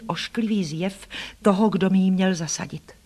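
usual speaking voice

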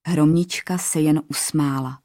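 usual speaking voice

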